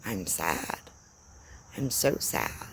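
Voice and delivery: softly admitting it, dropping right down; a low, hushed confession to yourself; quiet inner voice. Pretend. Giving her sadness a voice, said quietly. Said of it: "letting yourself hear".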